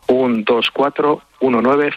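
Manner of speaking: slow, measured number read-out; slow repeat. Shown as a demonstration. Guest repeats the Bizum number for listeners, slowly. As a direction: dictate the number unhurriedly. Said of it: "deberíamos decirlo más despacio".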